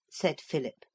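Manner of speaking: ordinary delivery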